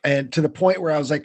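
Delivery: normal talking voice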